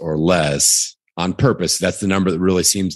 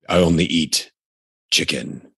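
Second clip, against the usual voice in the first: Jocko Willink voice. Shown as a demonstration. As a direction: drop into a stern ex-SEAL growl. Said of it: "I almost went into my Jocko Willink voice there".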